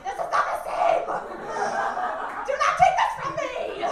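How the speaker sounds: loud, high mock outrage; hammed-up protest at full volume; indignant yell. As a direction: yell it like something is being stolen. Acted protest to weeping sister.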